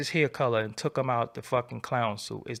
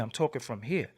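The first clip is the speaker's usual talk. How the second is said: softer, lower echo of "I'm talking from here"; softer repeat; 4 semitones lower, 6 dB quieter than usual. Shown as a demonstration.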